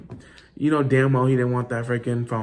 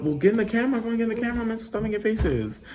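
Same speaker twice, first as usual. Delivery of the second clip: mom's bossy order, pitched higher; higher-pitched imitation of mom scolding; imitating mom; 9 semitones higher, faster than usual. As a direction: play the nagging mom, voice pushed up high. Mom voiced in retold scene.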